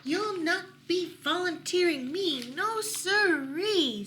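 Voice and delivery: high, loud nervous refusal; scared rabbit. Acted. Scared rabbit refusing to volunteer, high and nervous. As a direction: drag out no siree. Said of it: "said the scared rabbit".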